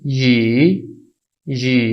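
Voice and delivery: यी drawn out, dipping then rising; falling-rising tone. Shown as a demonstration. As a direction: model third tone on यी. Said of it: "इसकी pronunciation होगी".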